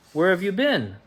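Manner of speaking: eager to hear her news; cheery question. A narrator's reading. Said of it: "he grinned".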